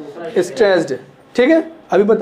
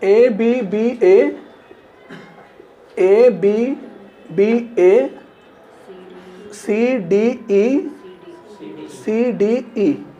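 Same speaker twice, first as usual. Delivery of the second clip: slow, drawn-out spelling; 4 dB quieter, much slower than usual. Shown as a demonstration. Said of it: "teacher marks the rhyme scheme on screen".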